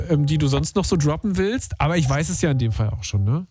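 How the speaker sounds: ordinary talk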